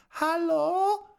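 very high, loud searching call. A narrator's reading. Unknown visitor calls to see who is there, calling out high. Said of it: "rief eine Stimme".

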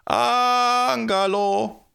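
long drawn-out, high, loud call. A narrator's reading. The people call the giant, calling out long and high. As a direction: holler the name across a great distance. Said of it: "Und sie riefen".

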